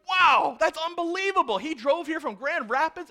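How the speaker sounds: loud, high wow of disbelief; high, loud delighted astonishment; amazed excitement. Pretend. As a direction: gush with amazement. Congregation reacting to miracle.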